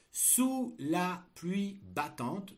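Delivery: slow, high dictation voice; dictating. Shown as a demonstration. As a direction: dictate slowly and clearly. Dictation of text chunk.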